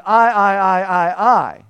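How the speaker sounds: loud, stressed I I I as Adam; insistent I. Retold or imitated. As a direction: hammer the word I, self-centred. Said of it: "But now Adam is saying".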